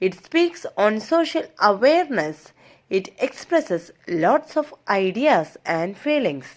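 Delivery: slow, measured read-aloud; textbook reading. Acted. Teacher reads English study notes to students, measured reading. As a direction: read it out slowly, phrase by phrase.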